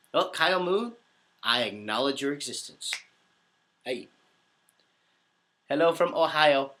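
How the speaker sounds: normal talking voice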